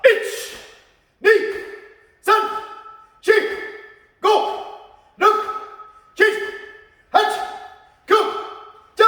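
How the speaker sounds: sharp, high, short martial arts count; power count. Shown as a demonstration. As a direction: call each number as an explosive high burst. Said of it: "spin pa".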